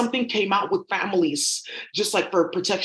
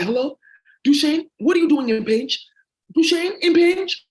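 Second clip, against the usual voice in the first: high, loud mock phone voice of her dad; imitating her dad; 7 semitones higher, 4 dB louder than usual. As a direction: ham up the dad squawking Hello? into the phone. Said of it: "That's how he talks".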